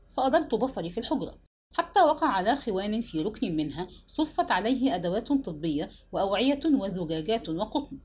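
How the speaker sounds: ordinary delivery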